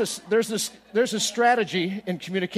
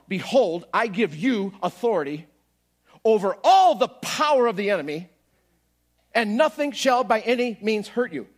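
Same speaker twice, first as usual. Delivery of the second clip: louder, building preacher's reading of the verse; scripture proclaimed; 4 semitones higher, 6 dB louder than usual. Acted. Preacher proclaiming Luke ten seventeen to the congregation. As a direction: declare it like a promise.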